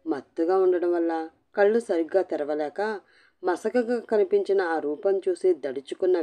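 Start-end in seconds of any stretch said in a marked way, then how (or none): none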